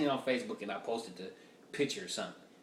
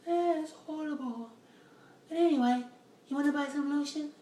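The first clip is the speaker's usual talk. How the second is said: shocked woman, then sales pitch; 5 dB louder than usual. Retold or imitated. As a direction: drag out the fake sympathy, then brightly pitch the lotion. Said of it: "she was like".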